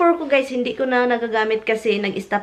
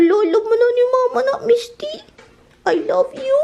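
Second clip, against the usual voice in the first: much higher, quick, loving coo; cooing to pet; 13 semitones higher, 4 dB louder than usual. Real, not acted. Owner telling her dog Misty she loves her, in a high loving coo. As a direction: sweet-talk the dog in a squeaky coo.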